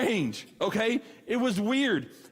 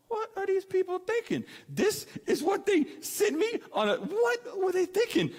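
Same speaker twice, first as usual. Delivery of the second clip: high, stunned disbelief voice; incredulous; 12 semitones higher than usual. Retold or imitated. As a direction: gape at what they sent you, high-pitched. Shocked on a bad blind date.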